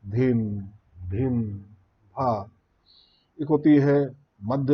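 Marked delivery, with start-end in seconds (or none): 0.1-2.5 slow, spaced chanted bols; slow vilambit bols. Shown as a demonstration. Teacher demonstrates slow tempo to students, slow. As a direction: lay each bol out slowly. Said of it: "जो कि slow होती है".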